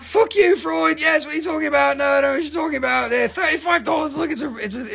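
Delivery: high-pitched comic rant; mock outrage. Comic outburst at Freud.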